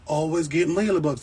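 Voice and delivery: imitating New Yorkers' way of saying always get mealybugs, hammed-up; mock New York accent. Mimicking New York plant buyers for viewers, mock New York accent. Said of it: "They, they talk like that".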